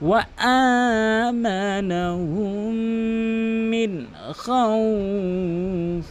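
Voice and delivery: slow, drawn-out chanted recitation; Quran recitation. Shown as a demonstration. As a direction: recite with long stretched vowels. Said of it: "Hamza কিন্তু এক আলিফ টেনে পড়তে হবে". (ধীর টেনে টেনে কুরআন তিলাওয়াত)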